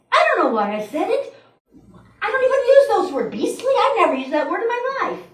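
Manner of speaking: baffled by your own words, half laughing; flustered admission. Retold or imitated. Butterworth confessing his odd word to the congregation, high and flustered. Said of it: "Butterworth himself even admits".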